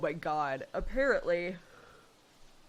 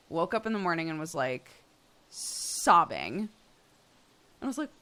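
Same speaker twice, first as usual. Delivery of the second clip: normal talking voice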